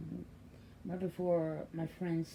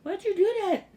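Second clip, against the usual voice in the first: higher, louder playful mock reproach; mock scolding baby; 12 semitones higher, 10 dB louder than usual. Grandmother to baby after he wet her, playful mock reproach. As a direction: tease the baby like he's in trouble. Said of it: "I'm looking at him like".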